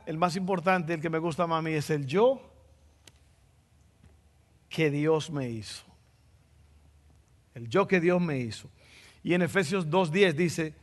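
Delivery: ordinary talk, nothing marked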